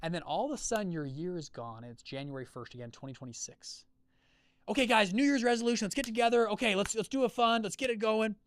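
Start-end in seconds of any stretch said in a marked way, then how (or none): 4.7-8.4 mock rallying cry. Mocking New Year's enthusiasm.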